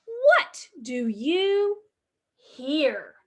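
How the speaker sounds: slow, sing-song picture book reading; reading to children. Acted. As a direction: read the refrain slowly to little ones. Reads the rhyming book refrain.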